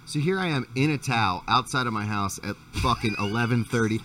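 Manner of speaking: normal talking voice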